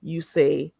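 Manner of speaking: ordinary talk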